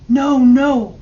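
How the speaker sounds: loud, high pleading no, no; boy dragged off by guards, begging loudly. A narrator's reading. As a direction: cry out no, no in desperate pleading. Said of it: "I begged".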